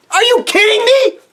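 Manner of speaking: high, loud disbelief; real fan exasperation; exasperated rant. Real, not acted. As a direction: demand it in outrage. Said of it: "outraged rant at Kirk".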